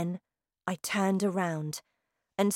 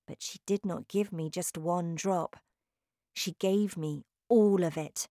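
ordinary delivery

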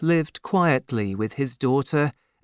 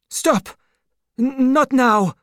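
put-on sharp, much higher cry of an old man; alarmed outcry; 12 semitones higher, 5 dB louder than usual. A narrator's reading. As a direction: cut him off in alarm, loud and high. Doctor cuts off the secret.

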